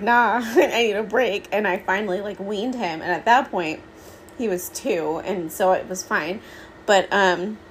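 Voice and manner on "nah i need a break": slow laughing mock brush-off; amused, drawn-out refusal of more; laughing nah. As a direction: laugh it off, taking your time. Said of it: "And then I was like".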